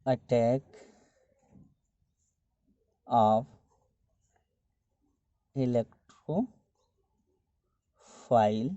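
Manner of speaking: word by word, long pauses between words; slow dictation. Real, not acted. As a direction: dictate it slowly as you write. Dictating the step title while writing. (लिखते हुए रुक-रुककर, एक-एक शब्द)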